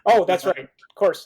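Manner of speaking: ordinary delivery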